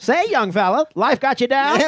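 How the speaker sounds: put-on high old-timey announcer voice; mid-Atlantic accent. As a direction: pitch it like a 1920s radio spot. Old-timey hotel ad bit.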